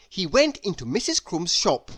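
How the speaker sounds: usual speaking voice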